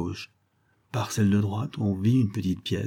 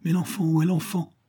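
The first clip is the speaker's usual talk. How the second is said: higher-pitched urgent question; acted cry of alarm, pitched up; 8 semitones higher than usual. A narrator's reading. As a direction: call out in alarm for the child. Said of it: "cria monsieur Pocelet".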